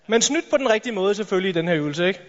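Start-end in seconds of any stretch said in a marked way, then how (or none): none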